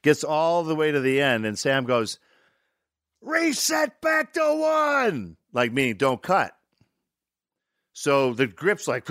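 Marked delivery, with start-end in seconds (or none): 3.2-5.4 high, long drawn-out shout; director calling out. Retold or imitated. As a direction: holler it across a film set, stretching each word. Director calls out across the set.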